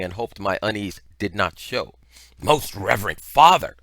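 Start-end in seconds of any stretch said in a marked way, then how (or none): 2.4-3.8 loud, ceremonious call, pitched to a crowd; projected, declamatory greeting; loud public address. A narrator's reading. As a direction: hail the priest loudly for all to hear. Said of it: "in a voice loud enough so all onlookers might hear".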